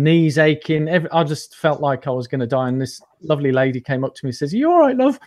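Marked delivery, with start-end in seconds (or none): 4.5-5.2 much higher, louder mock lady's accent; bad lady's voice. Guest imitating a lady who found him collapsed, in a high, loud put-on lady's voice. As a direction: squeak it out like a kindly local lady asking after him. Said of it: "my bad attempt at a lady's accent".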